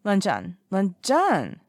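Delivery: whiny repeated call; insistent calling. A narrator's reading. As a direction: pester him for attention. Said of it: "none too gentle poke".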